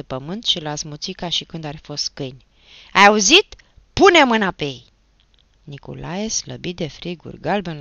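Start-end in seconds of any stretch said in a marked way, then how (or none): 2.9-4.8 very loud, raised command; forceful yell; shouted order. A narrator's reading. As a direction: bark it like setting dogs on them. Achim orders brother to attack.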